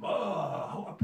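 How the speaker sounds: put-on slow, loud, high sneer of a drunk; drunken brush-off. A drunk snarling at the preacher, slow and high. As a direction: wave him off like a drunken lout.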